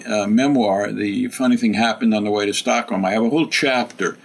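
normal talking voice

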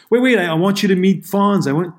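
imitating someone rushing over with an introduction; excited urging. Actor rushing his wife over to meet Fonz, excited urging.

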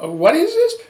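a high startled squeak of fear; alarmed question. Retold or imitated. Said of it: "I said".

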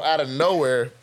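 ordinary talk, nothing marked

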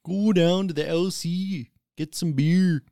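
high, loud, slow mock Canadian; Canadian accent. Shown as a demonstration. Friend planning a beer run, mock Canadian accent. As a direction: draw it out high in exaggerated Canadian. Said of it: "you say the Canadian accent".

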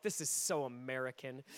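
low, quiet dismissive aside, retold; understated, low mock disdain. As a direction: mimic someone privately scoffing, voice dropped low. Retells Brit's dismissive private thought.

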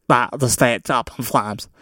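mushy, dopey mock president; dumb president impression. Host mocking the president over the fires, dopey impression. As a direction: play the president as a slurring dimwit. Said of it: "gonna be my impression for the president".

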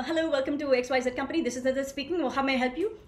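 a mild, lightly voiced call-centre agent rattling it off; rushed helpline greeting. Shown as a demonstration. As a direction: gabble the script like a bored agent. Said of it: "I'm gonna say".

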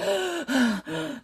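sharp mock gasp for air, pitched high; quick imitated gasp. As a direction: act out a gagged woman gasping. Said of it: "and she's like".

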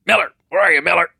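high, loud call across the showroom; screaming a name. Retold or imitated. Old customer calls the sales manager across the showroom, shouting. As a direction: yell for Miller, pitched high and loud. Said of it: "And he's screaming".

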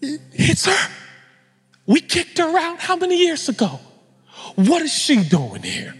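high-pitched scandalized outburst; aghast. Acted. Audience seeing the woman they kicked out, aghast. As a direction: exclaim it high, in acted shock. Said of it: "the audience had to be aghast".

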